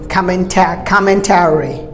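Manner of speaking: commentary prolonged to COM-men-TAIR-ee; American commentary, four clear syllables; American pronunciation. Shown as a demonstration. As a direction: give commentary a full "tary". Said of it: "Americans prolong the word".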